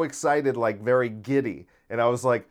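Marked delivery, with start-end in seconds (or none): none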